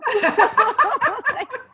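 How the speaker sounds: high laugh out of embarrassment; embarrassed laugh. Real, not acted. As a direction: laugh it off, a little flustered. Laughs at her own choking up.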